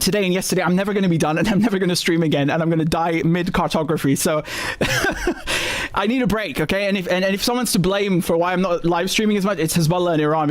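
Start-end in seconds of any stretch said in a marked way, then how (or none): 4.5-5.9 very high, breathy giggle; high burst of real laughter; self-mocking laugh. Real, not acted. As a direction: giggle helplessly at your own plight. Laughs at dying mid-cartography.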